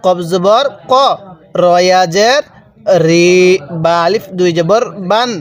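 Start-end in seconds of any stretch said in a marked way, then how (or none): 0.1-0.9 "ক" with a rounded "o" vowel, not "aa"; correct reading. Shown as a demonstration. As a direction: model "কফ জবর ক" with a final "ko". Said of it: "তাহলে কিভাবে পড়তে হবে".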